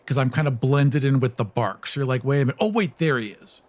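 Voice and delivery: ordinary talk, nothing marked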